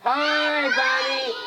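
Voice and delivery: high, drawn-out group greeting; high-pitched call to a crowd of children. Shown as a demonstration. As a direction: lead the kids in a bigger hello. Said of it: "say a little louder".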